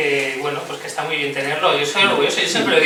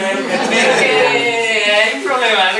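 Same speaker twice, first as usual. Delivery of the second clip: imitating the head of studies; 6 semitones higher, 7 dB louder, much slower than usual. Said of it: "me dice la jefa de estudios".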